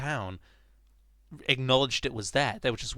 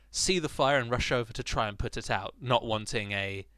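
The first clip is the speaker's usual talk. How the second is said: normal talking voice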